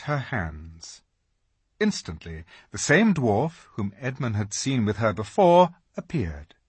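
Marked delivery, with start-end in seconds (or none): none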